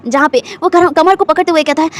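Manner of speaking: ordinary delivery, nothing marked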